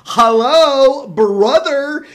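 high, loud, theatrical hail; hearty calling out. Acted. Soldier hailing Saint Peter about a chance, calling out. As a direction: call out boldly to a companion.

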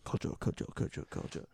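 fading whispered repeat; whispered echo. Pretend. Echoing the title into a fading whisper.

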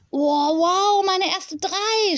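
high, amazed and proud; beaming with pride. A narrator's reading. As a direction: burst out proudly, stretching the last words. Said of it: "strahlte er stolz".

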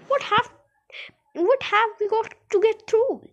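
very high, loud stammered question; alarmed shouting. A narrator's reading. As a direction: stumble into a panicky high question. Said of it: "shouted Grandpa Joe".

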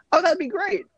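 high-pitched, eager acceptance in a retold scene; delighted party guest. Party guest offered a custom jacket, delighted.

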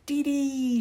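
put-on high, loud toddler call; happy cry. A narrator's reading. Small girl calling out from the top step, high excited cry. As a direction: sing out the name in a high little voice. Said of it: "she cries".

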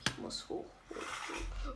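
tense hurried whisper; scared whisper. Real, not acted. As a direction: whisper it while fleeing. Scared, fleeing upward.

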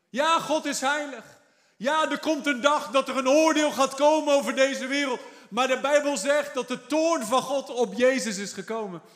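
full-force preaching of God's judgment; fervent preaching. Acted. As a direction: preach it out to the congregation.

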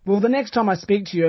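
normal talking voice

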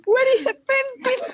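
high, shouted through laughter; laughing shout. Real, not acted. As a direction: shout it while crying laughing. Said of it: "Estoy llorando". (gritando entre carcajadas)

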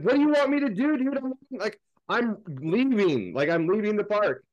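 imitating his own exasperated reply to the guard.

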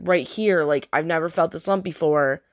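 usual speaking voice

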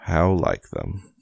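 slow, drawn-out gloomy donkey voice; Eeyore. Shown as a demonstration. Reader tries out her Eeyore voice, slow and gloomy. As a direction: drag the words out glumly. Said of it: "Eeyore is about the only voice".

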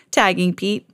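plain reading voice

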